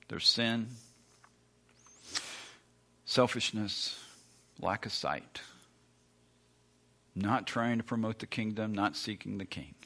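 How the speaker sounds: normal talking voice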